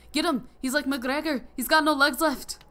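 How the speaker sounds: mimicking a cheering Irish family in a bad Irish accent; terrible Irish accent. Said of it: "my terrible Irish accent".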